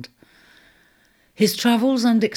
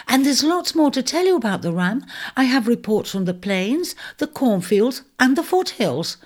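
high, eager little mouse voice rattling off gossip; a breathless gossipy mouse spilling news; chattering mouse; 7 semitones higher than usual. Acted. Mouse keeps reporting.

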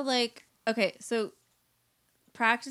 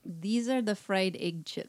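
slow, careful Russian accent; put-on Russian accent, drawn out; much slower than usual. Shown as a demonstration. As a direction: try a Russian accent, word by word. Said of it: "Do a Russian accent".

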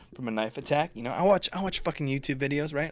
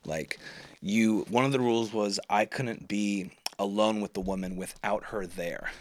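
ordinary talk

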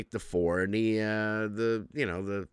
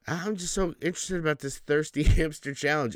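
put-on higher, airy valley girl parody, giggling through it; mock ditzy girl; 7 semitones higher than usual. Mimicking the TikTok girls with a higher giggly voice.

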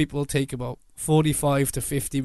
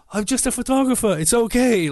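high, breezy brush-off; dismissive; 9 semitones higher than usual. Retold or imitated. Brushing off the shouting man with a high breezy voice. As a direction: wave it away airily.

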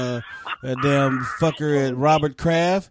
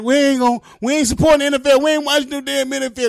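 mock fired-up vow; 10 semitones higher, 5 dB louder, faster than usual. Fans voiced vowing a boycott.

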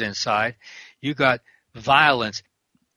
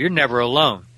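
ordinary delivery

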